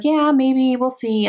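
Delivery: high, slow, doubtful drawl; skeptical shrug. Retold or imitated. She retells first hearing about the book, doubtful and slow. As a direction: sound unconvinced, dragging it out. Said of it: "I was like".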